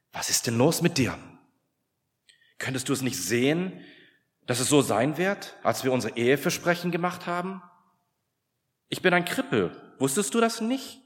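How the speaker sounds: wife lashing out in bitter frustration; angry outburst. Retold or imitated. Wife lashes out at husband.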